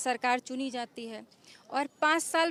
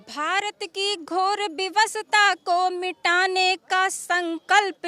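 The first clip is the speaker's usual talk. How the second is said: poem recitation; 2 semitones higher, 6 dB louder, much slower than usual. Acted. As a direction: declaim a heroic ode of praise, stretching each phrase. Recites her poem of tribute.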